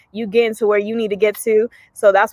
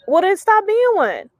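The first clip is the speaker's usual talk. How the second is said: high-pitched, drawn-out comeback; slow, high mock exasperation; sassy retort; 9 semitones higher than usual. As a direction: toss it out as blunt, obvious advice. Acted retort to self-doubting filter users.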